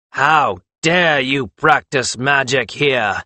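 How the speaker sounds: slow, emphatic fury; imperious, outraged Magus demanding obedience; beside himself with rage. A narrator's reading. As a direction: rage at them, weighting each word. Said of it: "sounded nearly beside himself with rage".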